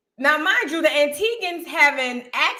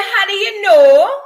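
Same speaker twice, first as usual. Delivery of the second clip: higher, louder, slow astonished question; surprised; 7 semitones higher, 9 dB louder, slower than usual. Retold or imitated. Girl on horseback stunned the guide guessed right, surprised. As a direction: act shocked he guessed it. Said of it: "And she said".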